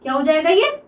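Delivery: normal talking voice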